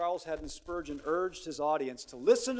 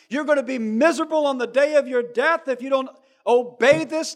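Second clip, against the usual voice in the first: loud, high pleading shout; crying out; 6 semitones higher, 14 dB louder than usual. Retold or imitated. Elder preacher crying out to young Spurgeon, loud shout. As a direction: call out to the young man, pounding. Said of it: "began to cry out".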